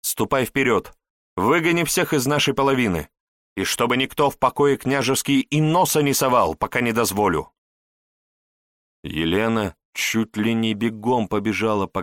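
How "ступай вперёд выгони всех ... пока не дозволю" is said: lightly voiced, brisk orders; a hint of a mistress giving commands; commanding princess. Princess orders maid to clear the rooms, mildly commanding.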